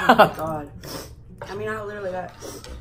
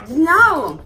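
higher, much louder no; loud protest; 9 semitones higher, 14 dB louder than usual. Real, not acted. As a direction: shout it down. Heated argument over the rules.

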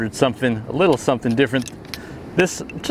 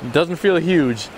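normal talking voice

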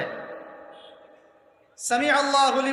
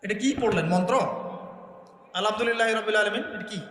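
ordinary talk

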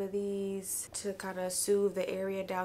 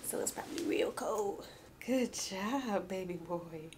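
whispering. Real, not acted. Baby asleep nearby, hushed.

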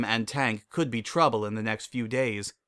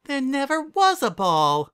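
very high, slow, crushed; dismayed dog voice; 14 semitones higher, 6 dB louder than usual. Acted. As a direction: let it sink in slowly. Winona dismayed at lie.

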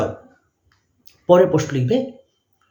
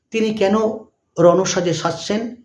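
dictating; 2 semitones higher, slower than usual. Shown as a demonstration. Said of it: "dash দিয়ে লিখবে".

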